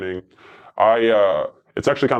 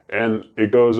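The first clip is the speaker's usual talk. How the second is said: ordinary talk, nothing marked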